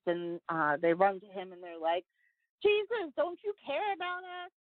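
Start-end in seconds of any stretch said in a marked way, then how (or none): 2.6-4.5 high-pitched, pleading mock alarm; mock frightened disciples. Retelling disciples begging Jesus in the storm, mock fright. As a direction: imitate panicked followers appealing to their master. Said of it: "all the disciples are scared shitless".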